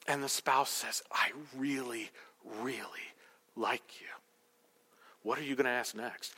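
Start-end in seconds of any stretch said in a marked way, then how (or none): 1.1-4.1 halting, very soft whisper; hedged affection. Acted. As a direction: whisper it with pauses between words. Spouse repeats hedged reply.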